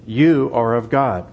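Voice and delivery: "You" leaned on, slow and weighty; stress on YOU. Shown as a demonstration. Preacher showing the Greek emphasis, slowly stressing "You". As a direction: press hard on the word "You", then slow the rest. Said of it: "the word you is in the emphatic position".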